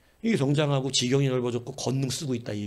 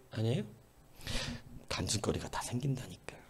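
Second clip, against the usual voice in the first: whisper; 4 semitones lower, 12 dB quieter than usual. Real, not acted. Pastor confides aside.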